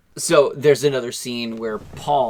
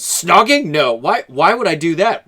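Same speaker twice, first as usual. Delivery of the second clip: lightly acted Paul, higher-pitched protest; mock denial; 5 semitones higher, 5 dB louder than usual. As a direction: play at being caught out and denying it. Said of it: "And Paul, he goes".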